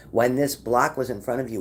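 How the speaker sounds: normal talking voice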